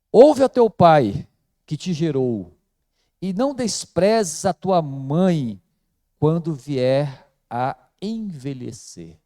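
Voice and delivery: loud, slow, solemn proclamation of the verse, starting with "Ouve a teu pai"; scripture reading. Shown as a demonstration. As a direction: read the proverb aloud like a pulpit declaration. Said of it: "Diz assim".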